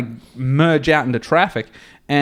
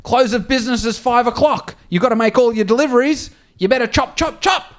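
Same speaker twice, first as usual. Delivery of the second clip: bossy boss voice; 8 semitones higher than usual. Retold or imitated. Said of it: "He's like".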